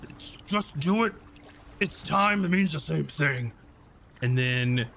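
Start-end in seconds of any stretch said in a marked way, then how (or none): 0.0-3.5 exasperated bugbear arguing back; higher, put-on squabbling voice; Gato. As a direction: argue the point as an impatient bugbear. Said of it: "Gato goes".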